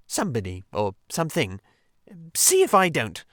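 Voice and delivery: a pompous little grumble; Mole, indignant. Acted. Mole grumbling self-importantly.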